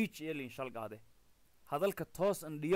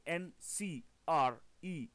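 slow spelling out; slower than usual. Shown as a demonstration. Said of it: "hingaadiye na ata I".

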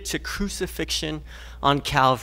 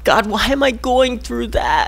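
anguished cry; 6 semitones higher than usual. Acted. Voicing a struggling believer.

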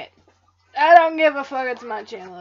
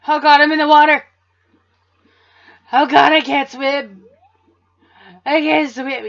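mock panic; 3 semitones higher, 14 dB louder than usual.

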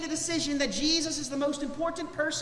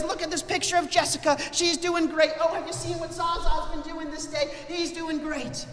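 higher, excited showing-off of the grandchildren; proud bragging grandma; 6 semitones higher, 4 dB louder than usual. Acted. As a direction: gush like a grandmother with wallet photos. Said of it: "God like a grandma".

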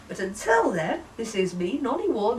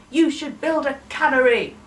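higher, louder insistent order; bossy demand; 5 semitones higher, 8 dB louder than usual. Acted. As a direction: push it as a demand. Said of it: "rather than demand".

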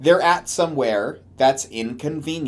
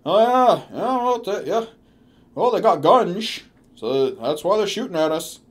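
mock mutter; 4 semitones higher than usual. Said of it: "Paul muttered".

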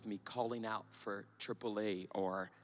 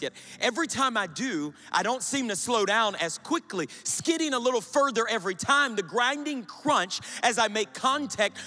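loud, higher, defiant performance; heated spoken word; 11 semitones higher, 12 dB louder than usual. Acted. Reckless driver defending freedom, loud and defiant. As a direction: push it like a driver losing control.

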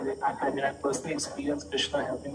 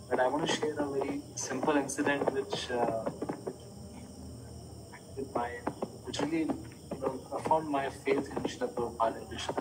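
barely audible; 6 dB quieter than usual. Real, not acted. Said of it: "Your voice is very faint".

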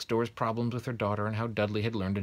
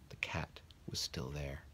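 hushed narration; 5 semitones lower, 11 dB quieter, slower than usual. A narrator's reading. Suspenseful narration.

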